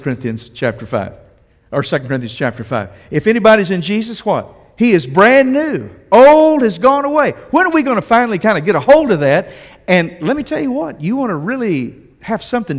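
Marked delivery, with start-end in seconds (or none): 3.1-9.5 rising, louder fervent preaching; preacher climbing to a fervent peak; full-force preaching. Real, not acted. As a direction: lift the congregation up.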